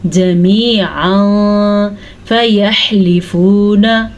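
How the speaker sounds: फयहलिफूना with a clear breathy throat ha, slow; slowly, careful throat ha. Shown as a demonstration. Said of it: "हा के तलफ़ुज़ का ध्यान रखें". (हा का सही तलफ़्फ़ुज़, धीमे से)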